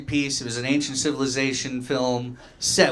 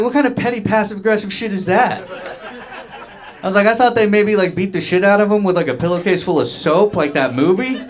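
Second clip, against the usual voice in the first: higher, louder mock outrage; incredulous outburst; 8 semitones higher, 4 dB louder than usual. Comic retelling his outrage at grandpa's story, loud and incredulous. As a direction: blurt it out in disbelief.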